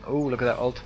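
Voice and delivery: normal talking voice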